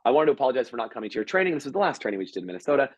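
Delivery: rattled off at a fast clip; fast retold apology. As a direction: rush through the excuse cheerfully. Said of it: "So he goes".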